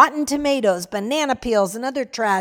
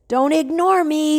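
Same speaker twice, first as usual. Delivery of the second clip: higher, louder demand; shouted; 6 semitones higher, 3 dB louder than usual. Acted. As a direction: yell it at the man who ignores you. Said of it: "the voice shouted".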